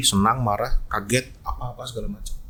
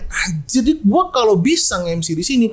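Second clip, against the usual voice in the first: excited inner voice; 6 semitones higher than usual. Retold or imitated. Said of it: "gua udah yang".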